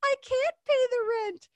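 very high, slow helpless damsel. Acted. Cartoon girl tied to the tracks, pleading in a high helpless voice. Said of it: "then she would go".

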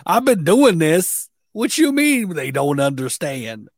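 high, loud mock indignation; offended. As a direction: play the insulted expert, voice pushed up. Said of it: "He's, like, offended".

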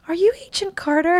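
put-on high, wondering child voice; tiny high-pitched question; imitated little girl. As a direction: ask like a small child meeting a hero. Little girl asking her hero.

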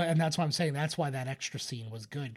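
ordinary talk, nothing marked

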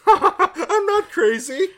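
high, slow laughing "I'm not crazy". Acted. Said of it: "says Nancy, laughing".

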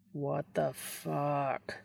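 slow, soft groan through the words; a long exasperated groan. Acted. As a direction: drag it out in a tired groan. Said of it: "Dean groans again".

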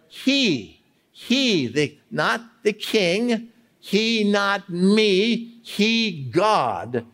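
"he" high and isolated each time; emphasizing each word. Shown as a demonstration. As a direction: punch "he" high and slow, then drop on "God". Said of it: "stop and emphasize each word".

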